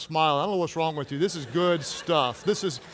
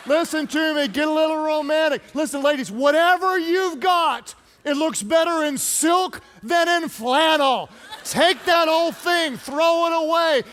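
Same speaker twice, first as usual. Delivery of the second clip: raised, urgent preacher's exhortation; full-force preaching; 8 semitones higher than usual. Real, not acted. Preacher urges wives to get romantic, full-force preaching. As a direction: exhort them point by point, pitched high.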